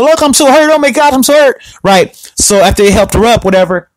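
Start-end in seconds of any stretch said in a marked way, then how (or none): 0.0-1.6 high-pitched melodramatic whining imitation; mock theatrical. Retelling the mom's staged fall, mock theatrical. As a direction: play up being hurt in a mocking way. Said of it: "like, was really theatrical".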